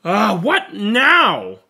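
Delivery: drawn-out, exasperated groan of a question. Acted. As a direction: let the frustration spill out slowly.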